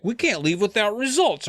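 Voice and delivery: high, pushy dad voice; stubborn dad. Acted. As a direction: insist like a stubborn father. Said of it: "he's like".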